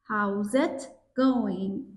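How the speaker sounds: slow careful take. Shown as a demonstration. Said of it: "این گ رو نمیخواد تلفظ بکنید".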